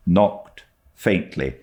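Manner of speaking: plain reading voice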